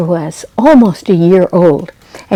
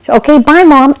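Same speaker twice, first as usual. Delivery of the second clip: put-on little girl voice; 6 semitones higher, 4 dB louder than usual. A narrator's reading. Girl says goodbye.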